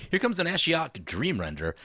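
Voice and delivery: usual speaking voice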